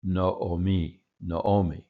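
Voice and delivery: Noomi with two O sounds in a row, no-o-mee; both O's sounded separately in "Noomi"; Hebrew pronunciation. Shown as a demonstration. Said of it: "you have the two O sounds in a row".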